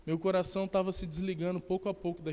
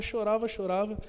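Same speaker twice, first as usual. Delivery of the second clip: normal talking voice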